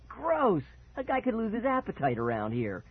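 high-pitched mock disgust; gleeful cry. A narrator's reading. Brother jeering at spat-out food, high gleeful cry. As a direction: squeal the first word, then tease. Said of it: "Mickey cried, grinning".

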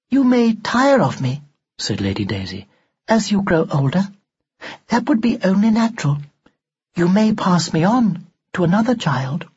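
high, prim and emotionless; an old-fashioned doll speaking without feeling; expressionless doll voice. A narrator's reading. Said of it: "Expressionless, as always".

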